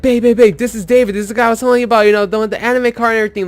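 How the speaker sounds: ecstatic. Retold or imitated. Friend introduces him to his girlfriend, ecstatic. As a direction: mimic a friend gushing nonstop. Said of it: "He's, like, fucking ecstatic".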